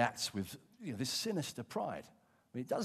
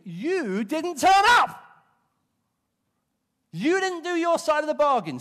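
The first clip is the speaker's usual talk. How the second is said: louder, rising to an indignant accusation; defensive blame; 8 semitones higher, 12 dB louder than usual. Retold or imitated. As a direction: turn it back on Samuel, loud and affronted. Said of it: "He's wriggling like a fish on a hook".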